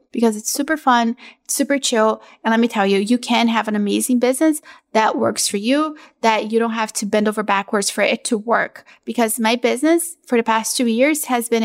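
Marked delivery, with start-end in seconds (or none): none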